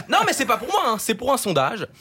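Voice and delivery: high-pitched feigned innocence; mock protest. Columnist denying the raise is for himself, mock protesting.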